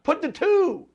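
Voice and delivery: high, loud meeting-room yell; acted yell. Somebody in a change meeting yells out a fix, acted yell. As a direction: yell it out like a big idea. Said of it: "somebody yells out".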